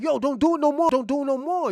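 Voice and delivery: high, loud pleading yell to stop; mock shout. Man yelling at doctors to stop erasing the chip, loud pleading shout. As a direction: yell at them to stop, half acting it out.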